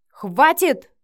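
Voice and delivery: loud, high angry command; furious outburst; barked. A narrator's reading. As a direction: bark it to cut the talk dead. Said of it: "рявкнул Матвей".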